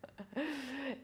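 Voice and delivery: light amused chuckle; short laugh. Real, not acted. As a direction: laugh at the silly cue. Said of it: "it's a little bit more comical".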